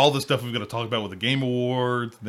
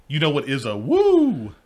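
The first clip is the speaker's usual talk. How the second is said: hype announcement; 5 semitones higher, 9 dB louder than usual. Acted. Hyping the show's name.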